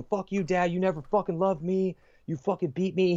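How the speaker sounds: retold furious scream. Veteran screaming at his absent dad in meditation, furious yell. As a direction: yell it out like a purging rage. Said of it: "He's just screaming out to the world".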